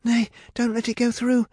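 high, urgent plea; begging. A narrator's reading. Rapunzel fears going through the portal, begging. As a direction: beg her in alarm to stop it. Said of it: "begged Rapunzel".

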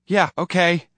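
high, loud, exasperated give-in; impatient. A narrator's reading. Roy giving in to the bully's grip, impatient. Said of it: "Roy said impatiently".

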